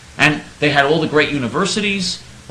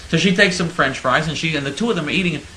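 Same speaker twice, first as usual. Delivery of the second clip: usual speaking voice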